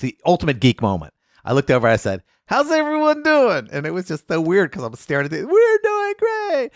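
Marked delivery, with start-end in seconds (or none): 2.5-3.7 high, slow, stretched-out emcee hello; retold showman greeting, much higher and slower; greeting a crowd. Greeting the packed theater crowd. 5.5-6.7 very high, slow sing-song crowd reply; hammed-up chorus of fans; mimicked crowd.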